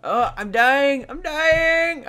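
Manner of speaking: high, loud yelps of panic; panicked yell. Real, not acted. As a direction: cry out that you're about to die. Panicked about falling in game.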